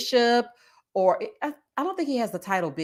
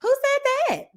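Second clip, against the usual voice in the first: mock catchphrases, climbing very high; [name]; 10 semitones higher than usual. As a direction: toss out catchphrases in a high imitated voice.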